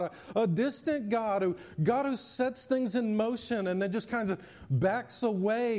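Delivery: preacher building to a peak; fervent preaching. Acted. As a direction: drive each line home to the congregation. Preaching at full force.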